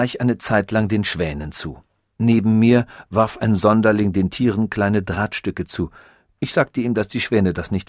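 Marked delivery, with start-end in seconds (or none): none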